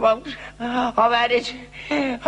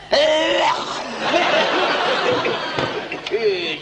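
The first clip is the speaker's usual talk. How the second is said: hacking cough; 7 semitones higher than usual. Shown as a demonstration. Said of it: "That's a cough".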